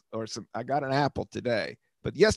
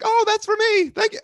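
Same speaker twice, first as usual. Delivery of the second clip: much higher, sugary mimicry of her; mock sweet lady; 6 dB louder than usual. The woman claiming the sandwich, mock sweet. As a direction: play the innocent, sing-song. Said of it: "she's like".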